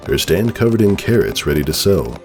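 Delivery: plain reading voice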